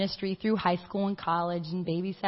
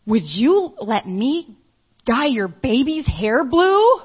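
slow, louder, a hint of an angry father; angry dad; 4 semitones higher, 8 dB louder, slower than usual. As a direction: drag it out like an upset father accusing. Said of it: "and he's saying".

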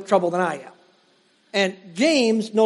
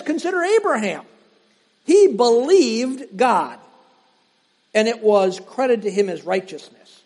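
raised, measured reading of Paul; preacher reading scripture aloud. Acted. Reads Galatians aloud.

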